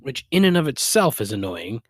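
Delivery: ordinary talk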